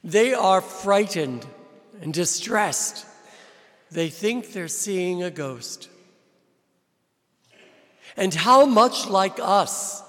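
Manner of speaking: ordinary delivery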